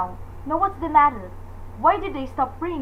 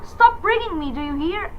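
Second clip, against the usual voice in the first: usual speaking voice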